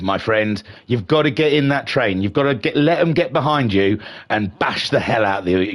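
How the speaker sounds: usual speaking voice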